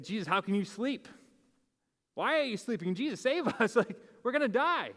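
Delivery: rising mock panic with a laugh; panicked disciples. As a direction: play the frightened disciples begging.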